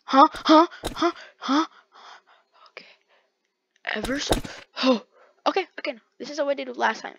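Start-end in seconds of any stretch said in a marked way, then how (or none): none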